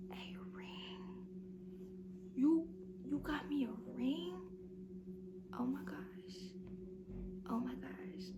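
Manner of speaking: breathless shocked whisper; stunned whisper. Retold or imitated. Seeing M J's ring in the car, an awed whisper. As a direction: barely get the words out.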